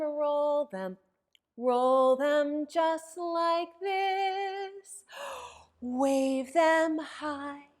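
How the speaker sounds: put-on bouncy chanted action rhyme for kids; sing-song rhyme. Librarian leading children in a warm-up rhyme, sing-song. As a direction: lilt it like a nursery chant.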